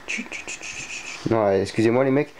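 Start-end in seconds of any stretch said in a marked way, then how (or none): 0.1-1.3 soft unvoiced shushing; breathy shh to calm a pet; whispered shush. Real, not acted. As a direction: hush the cat in a whisper. Hushing the agitated cat.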